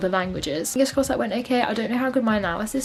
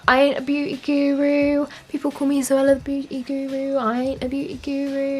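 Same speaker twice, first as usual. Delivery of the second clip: higher, playful imitation of a beauty vlogger; imitating Zoella; 5 semitones higher than usual. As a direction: quote her like a fan doing the voice. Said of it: "quotes from her videos".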